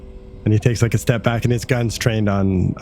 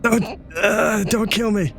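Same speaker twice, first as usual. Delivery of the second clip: high-pitched, halting begging; frightened pleading; 9 semitones higher, 3 dB quieter, slower than usual. Acted. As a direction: beg for your life, stammering. Cowering creature begs for its life.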